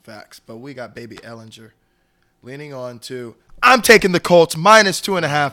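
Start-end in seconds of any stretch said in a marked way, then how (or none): 3.6-5.5 hyped, loud and high; excited shout. Real, not acted. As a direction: yell the pick like a hype man. Hyped pick announcement.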